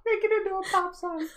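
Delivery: laughing and echoing the idea. Real, not acted.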